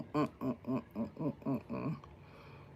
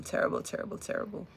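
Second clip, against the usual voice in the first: fading whisper; 9 dB quieter, much faster than usual. Real, not acted. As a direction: repeat "terrible" in a dying whisper. Lamenting children's mistreatment.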